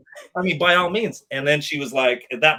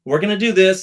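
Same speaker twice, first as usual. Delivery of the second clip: loud, firm final word; 6 semitones higher, 9 dB louder than usual. Retold or imitated. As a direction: shut the argument down. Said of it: "She goes".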